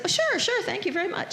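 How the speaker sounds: mock chirpy politeness; awkward polite yes. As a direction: fake enthusiasm to hide reluctance. Retold awkward agreeing to sit.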